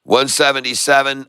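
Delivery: normal talking voice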